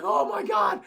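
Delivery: loud, high-pitched astonishment; mock amazed cry. Streamer acting out the actress seeing dinosaurs, loud astonishment. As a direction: exclaim it as an imitation of stunned awe. Acts out Laura Dern's amazed reaction.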